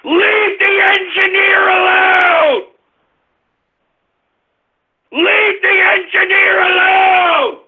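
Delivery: high-pitched, drawn-out angry yelling; outraged shouting. Real, not acted. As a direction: holler in protest, stretching the words. Host enraged at prank on engineer.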